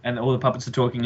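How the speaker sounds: usual speaking voice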